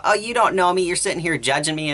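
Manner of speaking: mock whining. Mocking the friend's complaint, high whiny imitation. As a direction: parody her self-pitying whine. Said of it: "the whole whining about".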